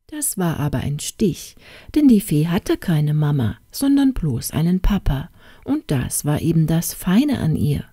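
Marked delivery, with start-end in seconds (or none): none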